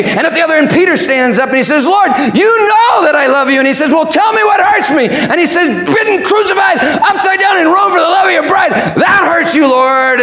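high, impassioned dramatic dialogue; full-force preaching. Acted. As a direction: preach the exchange at a fervent high pitch. Preaching at full force, voicing both speakers.

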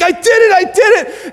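high, loud hammed-up victory cry; mock jubilant boasting; triumphant pastor. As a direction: crow over it like a pastor who won. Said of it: "then the pastor's like".

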